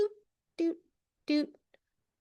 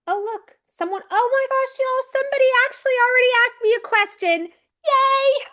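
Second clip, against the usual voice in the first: much higher, louder and fast, squealing with put-on delight; mock thrilled surprise; 12 semitones higher, 4 dB louder, faster than usual. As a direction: gush like a fan who just got a surprise. Pretends to discover a question.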